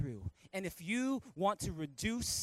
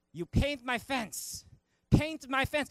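higher-pitched, drawn-out master's order; mock karate-master command; Mister Miyagi; 5 semitones higher, 5 dB louder, slower than usual. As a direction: insist on it like a stubborn teacher. Acting Miyagi giving orders.

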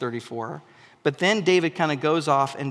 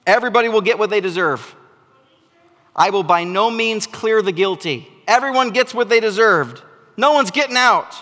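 loud, higher, stern divine judgement; voicing stern God; 5 semitones higher, 7 dB louder than usual. Preacher voicing God's verdict to Moses, loud and stern. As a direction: thunder the verdict like an unbending judge. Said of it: "God says to Moses".

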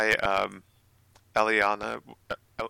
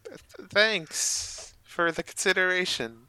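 slow high character voice; 8 semitones higher, slower than usual. Acted. Character thanks the alien.

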